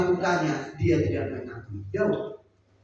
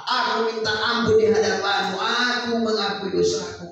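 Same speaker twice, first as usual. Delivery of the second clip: tearful plea; 8 semitones higher, 4 dB louder than usual. Acted. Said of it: "berlutut menangis-nangis".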